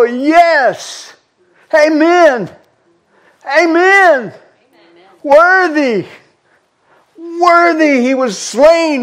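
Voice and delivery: high, ringing preacher's cry; full-force sermon climax; fervent preaching. Acted. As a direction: lift the room with praise.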